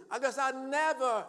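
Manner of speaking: loud, high, drawn-out moan; exaggerated defeatist at high volume; mock defeated complaint. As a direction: slowly wail a hammed-up self-pity.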